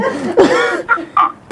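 high, breathy burst of giggling; giddy laugh. Real, not acted. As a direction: let the delight spill out as a laugh. Overjoyed at the anointing.

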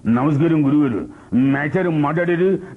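comic, stretched film-comedian voice; Relangi. Shown as a demonstration. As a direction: lift the pitch and drag the words comically. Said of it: "Relangi imitation వచ్చింది".

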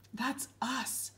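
small, soft confession. A narrator's reading. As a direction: barely voice the admission. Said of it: "said Ryan softly".

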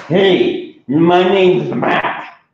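loud, slow big-monster hello; friendly boy monster. Acted. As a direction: introduce yourself big and easy. Monster Mac introduces.

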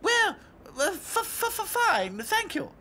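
high frightened stammering; scared stammer. A narrator's reading. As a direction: stammer out of fear.

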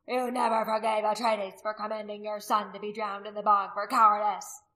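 loud man's accusation; accusing warrior. A narrator's reading. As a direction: accuse him in front of everyone.

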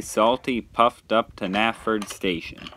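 plain reading voice

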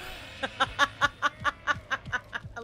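long staccato run of laughter; cackling laugh. Real, not acted. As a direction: laugh in rapid even bursts. Laughing at Leatherface.

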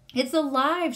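a narrator's restrained scream of alarm; mild scream. A narrator's reading. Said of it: "she screamed".

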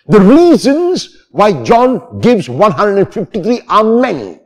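ordinary delivery

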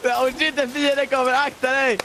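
high, hammed-up warning cry; mock alarm. Teasing friend with a mock enemy warning, high. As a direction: fake panic to tease.

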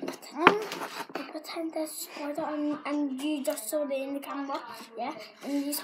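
ordinary talk

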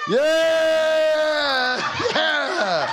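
high laughing yell of joy; joyful shout. Real, not acted. Cheering the kids running.

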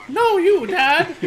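squeaky laughing imitation of a daughter. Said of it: "Is she talking to her dad".

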